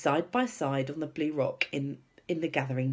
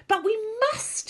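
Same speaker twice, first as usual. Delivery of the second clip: very high, loud insistence; high, emphatic little-girl plea; urgent cry; 6 dB louder than usual. A narrator's reading. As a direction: cry it out, pleading hard. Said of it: "Sophie cried".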